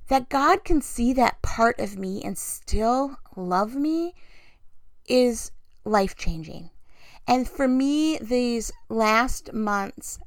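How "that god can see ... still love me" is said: ordinary talk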